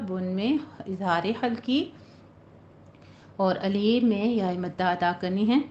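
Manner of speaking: usual speaking voice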